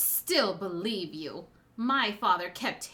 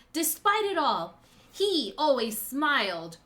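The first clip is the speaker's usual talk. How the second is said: impassioned speech, building louder toward the end; passionate; 5 dB louder than usual. Acted. Daughter recounting father's suffering to his betrayer, passionate. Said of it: "You were very passionate there".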